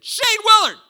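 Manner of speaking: high, loud challenging call; bully calling out. Retold or imitated. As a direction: bellow his name like a big kid itching to fight. Bully calls out the narrator.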